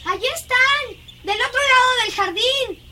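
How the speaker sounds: high-pitched excited exclamation; excited spotting. Acted. Girl spots the others across the garden, excited. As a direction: point them out in a high voice.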